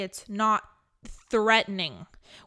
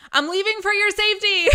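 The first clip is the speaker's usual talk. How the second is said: high, loud, fast mock panic; mock panicked exit; 10 semitones higher, 8 dB louder, faster than usual. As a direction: blurt it out as you flee the room. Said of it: "say it while you're running".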